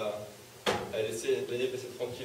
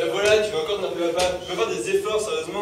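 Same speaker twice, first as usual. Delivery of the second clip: loud, higher-pitched furious scolding; angry shouting; 5 semitones higher, 9 dB louder than usual. Acted. Father demands efforts from his son, shouting angrily. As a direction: shout over the protests. Father's quarrel at table peaks.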